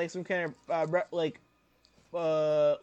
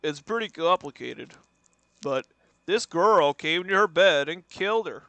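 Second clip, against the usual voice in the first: imitated TV doctor holding forth; mock-earnest TV counselor impression; Doctor Phil; 5 dB louder than usual. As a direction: put on a talk-show doctor voice. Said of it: "a half a Doctor Phil".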